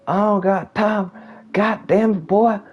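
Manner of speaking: hammed-up high-pitched rant about being interrupted; mock ranting voice. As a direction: squeal at the interrupting character in a silly high voice. Scolding a game character in put-on voice.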